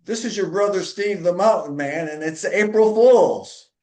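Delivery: spring the joke with a triumphant reveal; gleeful prankster brother. Retold or imitated. Brother Steve picking up the phone to reveal the prank, gleefully. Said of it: "Voice picks up the phone and says".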